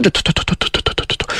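rapid, hushed t-t-t sputter; imitated shuddering stutter. A narrator's reading. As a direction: rattle off quick, soft trembling noises. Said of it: "一个劲儿直抖了".

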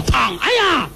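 high hammed-up ouch as she falls; fake fall cry. Old woman fakes a fall to amuse Yingning, hammed-up yelp. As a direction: play a pretend tumble, yelping. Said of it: "假装摔倒".

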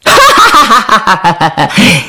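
long, high laughing fit; burst of laughter. Acted. Soldiers joking with the princess, high rowdy laughter. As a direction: laugh out in a high rapid cackle.

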